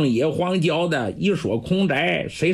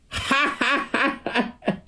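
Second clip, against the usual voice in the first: sheepish laugh; 10 semitones higher than usual. Acted. Old man laughs at his fear.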